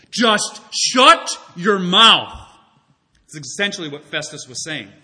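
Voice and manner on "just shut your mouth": slow, hard, louder order; forceful command. Acted. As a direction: spit each word out slowly, furious. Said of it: "he spoke with force".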